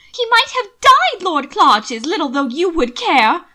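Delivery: high, loud furious shriek. Acted. Mina shrieking at Lord Clarges, high and furious. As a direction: scream it at him in outrage. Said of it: "shrieking at me".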